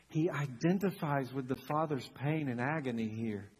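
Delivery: ordinary delivery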